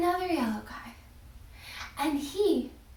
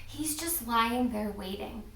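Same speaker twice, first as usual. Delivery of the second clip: ordinary delivery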